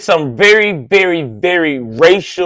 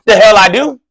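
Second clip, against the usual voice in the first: sharp, louder comeback; defiant snap back at a bystander; defiant retort; 4 semitones higher, 4 dB louder than usual. Retold or imitated. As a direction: throw it right back at them.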